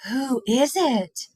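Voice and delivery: slow, lightly voiced girl question; curious girl. A narrator's reading. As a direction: ask with wide-eyed curiosity. Molly asking.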